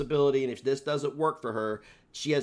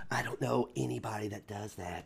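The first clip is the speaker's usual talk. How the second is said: whisper; 5 semitones lower, 8 dB quieter than usual. Real, not acted. Mock insult whispered aside.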